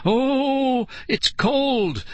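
high, drawn-out wail; distressed high whine at the cold. A narrator's reading. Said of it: "Nicole wailed".

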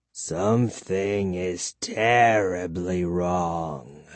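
very slow, low, soft vampire voice; troubled vampire. A narrator's reading. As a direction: draw out each word with quiet dread. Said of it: "the vampire repeated softly".

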